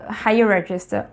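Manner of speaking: usual speaking voice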